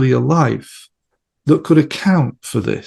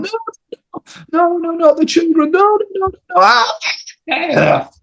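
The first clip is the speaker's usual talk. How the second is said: terrified screaming. Retold or imitated. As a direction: scream as if the soldiers are here. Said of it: "he started to scream and yell".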